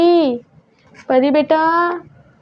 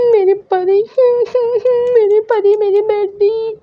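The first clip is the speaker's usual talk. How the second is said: high tearful wail, the words sobbed out; crying; 11 semitones higher, slower than usual. Acted. Mother sobbing for her missing daughter, high crying wail. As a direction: cry out for a lost daughter with the voice breaking. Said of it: "और mummy रोने लगती है".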